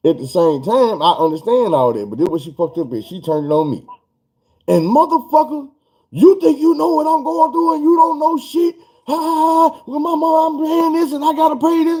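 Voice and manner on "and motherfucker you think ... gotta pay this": hammed-up angry girlfriend; mock rant with laugh. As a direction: stretch it out like her ranting. Girlfriend turns rant on him.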